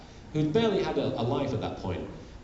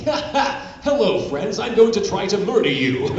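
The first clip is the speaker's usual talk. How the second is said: laughing, higher, louder manic villain; Meiko Tsunami; 8 semitones higher, 6 dB louder than usual. Retold or imitated. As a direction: cackle through a cheery murder threat. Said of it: "Meiko Tsunami's like".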